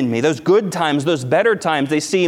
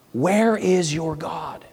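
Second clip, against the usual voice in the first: taunting; 3 semitones higher, slower than usual. Retold or imitated. Enemies taunting David.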